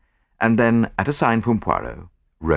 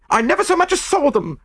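very high, indignant woman's voice; heated denial; 4 dB louder than usual. A narrator's reading. Chambermaid insists she never saw the pearls, heated. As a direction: protest hotly, pitched right up. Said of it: "she declared heatedly".

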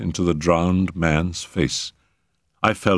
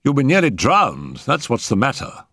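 emphatic, higher-pitched retort; grimly; 7 semitones higher than usual. A narrator's reading. Settle answering the man he rescued, grimly. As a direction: tell him bluntly, a touch grim. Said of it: "returned Settle grimly".

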